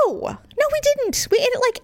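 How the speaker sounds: high, exasperated insistence; insist, pitch up high; emphatic denial. Retold or imitated. Wife correcting husband about dinner time, high and insistent.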